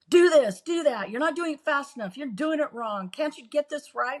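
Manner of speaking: mock-angry nagging commands; bossy yelling husband. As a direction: bark orders at her like a slave driver. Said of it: "boss me around and yell at me the whole time".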